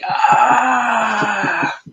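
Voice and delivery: sharp high cry of back pain; pained yelp. Pretend. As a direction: yelp like your back just gave out. Acting out throwing back in fight.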